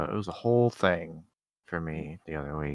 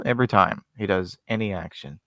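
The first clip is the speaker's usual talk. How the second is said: normal talking voice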